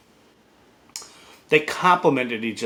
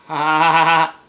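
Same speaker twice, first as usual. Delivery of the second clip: laugh; 6 dB louder than usual. Real, not acted. Laughing at his own joke.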